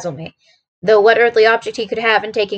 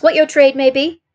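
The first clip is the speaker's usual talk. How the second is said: lightly affected blandness, raised and louder; bland politeness; 5 semitones higher, 4 dB louder than usual. Acted. Watson asks the messenger his trade, blandly polite. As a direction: put the question with polite blandness. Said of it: "I said in the blandest voice".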